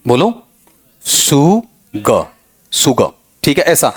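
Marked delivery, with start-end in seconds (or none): 1.0-3.1 "सुग" drawn out in two parts, su-ga, no r; correct pronunciation. Shown as a demonstration. Teacher modelling the right sound for students, slowly sounded. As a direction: sound out "सुग" slowly with a light opening sibilant. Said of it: "इसका सही sound बताओ".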